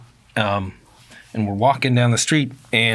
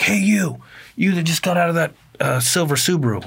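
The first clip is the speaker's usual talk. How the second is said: imitating an angry heckler, pitched up; mad stranger; 7 semitones higher than usual. Stranger calls to couple looking at bike, imitated mad. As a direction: call out in a mock-mad stranger's voice. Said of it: "This guy seems pretty mad".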